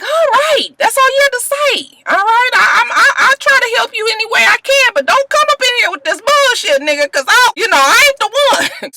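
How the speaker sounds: high laughing mock boss voice; Screwface relenting. Boss agrees to help but warns Willie, high and laughing. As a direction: give in, still scolding, with a laugh. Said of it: "Screwface was like".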